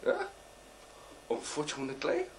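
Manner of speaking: ordinary delivery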